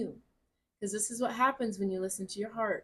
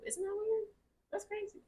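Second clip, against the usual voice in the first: surprised; 12 semitones higher, 6 dB quieter than usual. Real, not acted. Surprised at a name coincidence.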